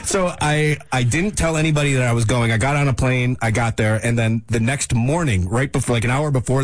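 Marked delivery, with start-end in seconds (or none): none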